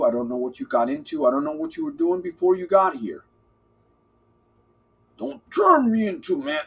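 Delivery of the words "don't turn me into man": Macho Man impression. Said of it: "Randy Savage".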